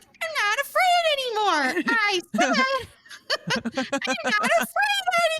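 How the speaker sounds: hammed-up defiant holler breaking into laughter; Home Alone's Kevin McCallister. Ex echoing the Home Alone scene, mock-defiant yell. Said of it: "like the scene in Home Alone".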